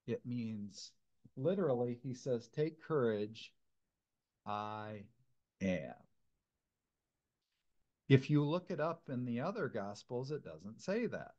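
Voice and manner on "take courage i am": slow and low, with weighty pauses; deliberate quote. Retold or imitated. Pastor giving the literal Greek to the group, deliberate quote. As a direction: quote it slowly and softly, pausing before "am".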